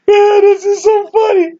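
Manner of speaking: high-pitched, helpless amusement; cracked up, voice way up high; laughing. Real, not acted. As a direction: squeeze the words out through laughter. Still cracking up.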